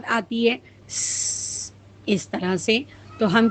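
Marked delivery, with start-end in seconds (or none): 0.9-1.7 soft voiceless hiss like a pressure cooker; cooker whistle hiss. Shown as a demonstration. Teacher imitates a pressure cooker's whistle with a hiss. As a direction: whistle out a thin sss. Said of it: "جیسے cooker کی سیٹی".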